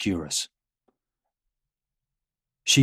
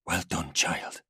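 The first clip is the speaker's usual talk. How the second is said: softer, lower, settled; relieved praise; 3 semitones lower, 6 dB quieter, slower than usual. A narrator's reading. Father brings daughter down and praises her, soft relief. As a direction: praise the child quietly after the ordeal. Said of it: "and feels relief".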